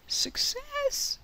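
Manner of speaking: very high, put-on squeaky cheer; a silly high-pitched echo of the cheer; squeaky victory cheer. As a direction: squeak it out like a cartoon character.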